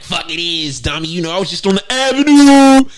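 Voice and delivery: swaggering put-on voice rising to a holler; mock street character. Mimicking himself as a street character.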